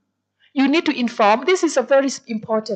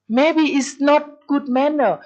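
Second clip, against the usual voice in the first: ordinary talk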